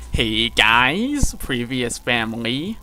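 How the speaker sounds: drawn-out delivery with rising phrase ends; slow vlogger-style upspeak impression; Dylan. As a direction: stretch words and swoop up at the end. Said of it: "in the Dylan voice".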